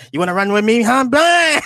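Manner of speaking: high, loud mock Jamaican challenge; Jamaican accent. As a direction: climb into a hammed-up Jamaican yell. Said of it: "talking that Jamaican accent".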